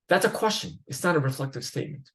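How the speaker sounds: normal talking voice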